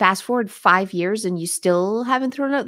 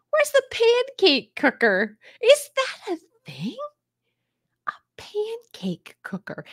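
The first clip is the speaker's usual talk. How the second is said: incredulous; 13 semitones higher than usual. Real, not acted. Puzzled by a viewer's comment.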